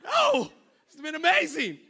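high, laughing disbelief; mock amazement; surprised denial. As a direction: act shocked she felt sick. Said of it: "we were like".

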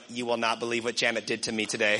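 low, fast mock grumbling; gossipy complaint. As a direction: act out venting the moment you get home. Acts out complaining on getting home.

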